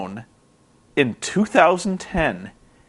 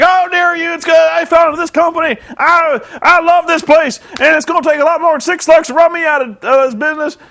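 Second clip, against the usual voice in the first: high, loud mock shout; indignant wrestler; 10 semitones higher, 4 dB louder than usual. Jeff Jarrett defying Eric Bischoff, high hammed-up shout. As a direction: ham up an outraged veteran's protest.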